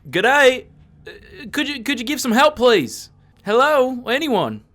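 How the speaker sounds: loud, high Aussie call for help; Australian accent. Acted. Player shouting for help into the cave, in an Aussie accent. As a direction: yell it down the dark passage, Aussie twang. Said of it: "I have an Australian accent".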